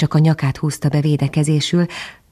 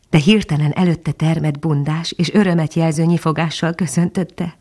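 ordinary delivery, nothing marked

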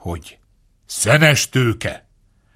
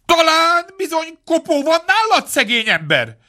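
much higher, suspicious fox calling in; alarmed fox. A narrator's reading. Fox outside the door, suddenly uneasy about hounds. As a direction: ask it nervously, high and worried.

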